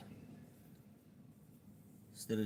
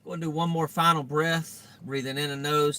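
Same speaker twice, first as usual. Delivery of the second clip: louder, coaching breath cue; guided meditation; 6 semitones higher, 8 dB louder than usual. Acted. Guides final deep breath.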